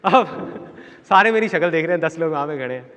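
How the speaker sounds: normal talking voice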